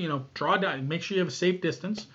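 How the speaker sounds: ordinary delivery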